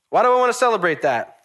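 high, loud, insistent question; emphatic repeated question. Real, not acted. Preacher asking why he celebrates, high and emphatic. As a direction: throw the question out with force.

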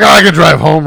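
loud retold self-assurance; stoned bravado. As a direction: blurt it out with cocky confidence. Acting out his own stoned bravado.

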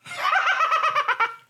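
high cackling evil laugh; Crypt Keeper evil laugh. Shown as a demonstration. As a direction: cackle high like a gleeful ghoul. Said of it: "I do the Crypt Keeper evil laugh".

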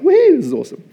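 high, loud cheer; a thrilled cheer at reading the Bible; excited woohoo. Retold or imitated. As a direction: whoop with real delight. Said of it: "I'm like".

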